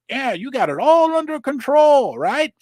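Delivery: mock reassurance, dripping with irony; mocking quote. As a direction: toss it off with a sneering "right?". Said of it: "They're like".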